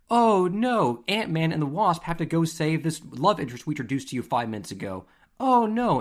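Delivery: high, loud hammed-up dismay; mock alarm. Moviegoer mocking manufactured superhero stakes, exaggerated alarm. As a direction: wail it like a fake blockbuster crisis.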